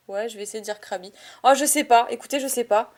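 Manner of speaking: normal talking voice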